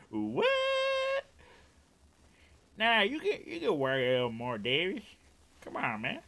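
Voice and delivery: put-on high, loud Vietnamese-accented protest; Vietnamese boss impression. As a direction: wheedle as a Vietnamese manager, pitch swooping up. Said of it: "He used to do that".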